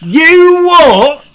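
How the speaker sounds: indignant high British outburst; mock-outraged British accent, rising high. Ribbing a friend over mixing accents, indignant British accent. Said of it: "when I try to do a British accent".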